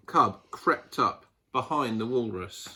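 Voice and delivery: usual speaking voice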